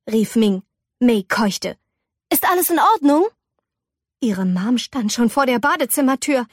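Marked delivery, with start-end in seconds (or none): 2.3-3.3 higher, rising question; concerned mother. Acted. Ming calls to May through the bathroom door, concerned.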